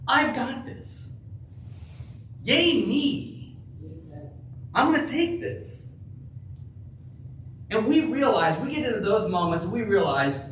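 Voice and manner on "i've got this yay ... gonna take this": high, smug mock bragging; lightly mocking cocky voice; mock boast. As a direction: play the proud self-reliant boaster. Mocking self-sufficient boast.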